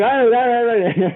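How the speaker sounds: put-on high, sputtering girlfriend who can't finish; upset girlfriend. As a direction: stammer it out in hurt disbelief. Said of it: "Now she's calling him up saying".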